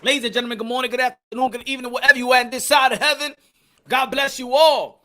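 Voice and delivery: loud, hyped host greeting; show host opener. Acted. Hosting opener to his boxing viewers, hyped. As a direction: open the show like a ring announcer.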